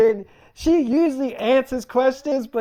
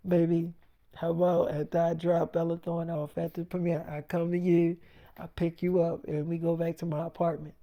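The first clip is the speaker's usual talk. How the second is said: much softer, coaxing offer; coaxing softly; 4 semitones lower, 9 dB quieter than usual. Retold or imitated. Boyfriend coaxing girlfriend into a bargain, soft and sweet. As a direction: sweet-talk her into a deal.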